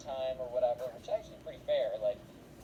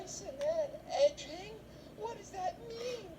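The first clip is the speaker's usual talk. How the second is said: put-on very high, whimpering, half-sobbed kid voice; a caught son whimpering to his mom, mock crying; slower than usual. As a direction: whine it like a caught kid close to tears. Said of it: "he's crying".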